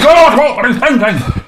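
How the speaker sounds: high, loud alarm; startled by what he hears; shocked, high-pitched question; real alarm. Real, not acted. Reacting to friend's distress.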